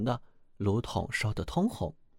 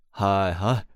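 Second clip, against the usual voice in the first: calling out; 3 semitones lower, 6 dB louder than usual. Acted. 汉斯又追上呼喊.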